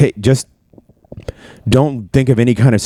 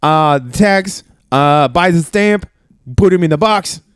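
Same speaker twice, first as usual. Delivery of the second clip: put-on Italian chef accent; 11 semitones higher than usual. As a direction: play a proud Italian chef in a thick Italian accent. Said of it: "start an Italian accent".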